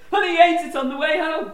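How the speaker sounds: Eddie repeating joke. Retold or imitated. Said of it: "his usual Eddie thing of going".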